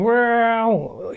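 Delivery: high, drawn-out cat voice. Retold or imitated. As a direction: imitate the cat's demanding whine. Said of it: "she is always going".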